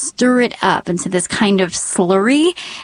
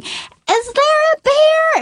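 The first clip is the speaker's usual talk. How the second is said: put-on much higher, slow curious toddler voice; small child voice; slower than usual. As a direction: ask it innocently like a little boy. Toddler son asking.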